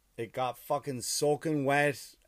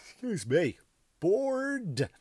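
long weary high sigh; tired sigh; 6 semitones higher, much slower than usual. Real, not acted. Tired comedian sighing mid-story. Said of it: "sorry, I'm just really tired".

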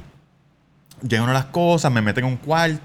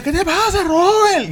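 much higher, louder, startled woman on the phone; alarmed coworker; 5 dB louder, slower than usual. Retold or imitated. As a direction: squeak it like a worried colleague. (compañera alarmada, voz muy aguda)

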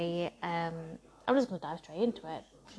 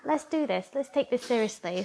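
pitched-up thrilled cheer, fuck yeah; excited whoop; 4 semitones higher than usual. Retold or imitated. To herself about more fans, excited high whoop. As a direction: psych yourself up with a high whoop. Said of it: "And I'm like".

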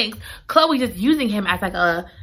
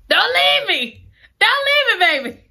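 pleading hero; 13 semitones higher than usual. Retold or imitated. Red pleading with Chloe not to leave, high begging. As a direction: beg her not to go.

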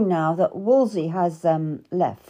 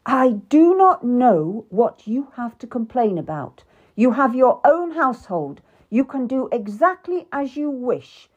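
louder, higher angry king; 5 semitones higher, 4 dB louder than usual. Acted. King Henry to the queen, angry and loud. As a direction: lash out at her complaints. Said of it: "He was really angry now".

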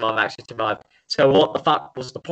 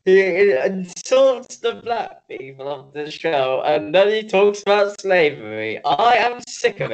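higher-pitched, hammed-up indignation; mock outrage; 5 semitones higher than usual. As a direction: protest in exaggerated, pretend offence. Mock outrage at the co-host's supposed racism.

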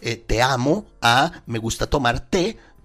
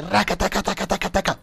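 rapid-fire rattle of nonsense syllables, pitched up; rattling gibberish; 6 semitones higher than usual. Retold or imitated. Mocking a student's unpunctuated messages to listeners, rattling gibberish. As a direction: reel off mock gibberish like a machine gun. Imitates rapid stream of garbled text.

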